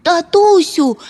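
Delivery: put-on high, loud child's call; excited yelling. A narrator's reading. Son calling to his lost father, yelling. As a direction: shout joyfully for daddy. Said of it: "галасував мумітроль".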